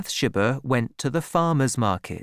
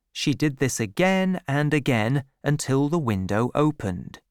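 usual speaking voice

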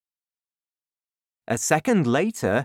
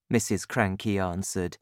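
usual speaking voice

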